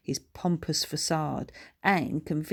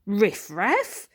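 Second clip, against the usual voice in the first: puzzled; 5 semitones higher, 6 dB louder than usual. Acted.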